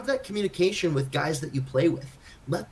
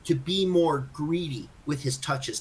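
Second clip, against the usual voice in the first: ordinary talk, nothing marked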